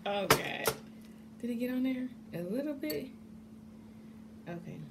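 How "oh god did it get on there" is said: ordinary delivery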